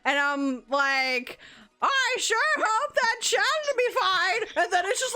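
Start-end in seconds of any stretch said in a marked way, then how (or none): 1.8-4.4 high, put-on granny; grandma voice. Streamer recalls worrying for a child in a game, high grandma voice. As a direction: replay it as a sweet-talking grandma. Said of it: "it's read in Grandma's voice".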